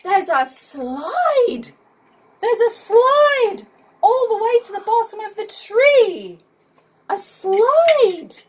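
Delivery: high, loud thrilled exclamation; excited cry. A narrator's reading. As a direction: shout it like a kid spotting a slide. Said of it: "Joe cried".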